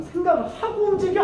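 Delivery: a hammed-up sergeant's rebuke; senior's rebuke. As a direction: scold the rookie in a put-on voice. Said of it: "하면 빡 때려요".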